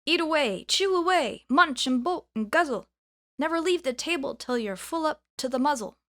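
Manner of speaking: pudding cheering the eaters on in rhyme; calling out. A narrator's reading. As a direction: chant the rhyme out across the table. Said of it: "the pudding sang out".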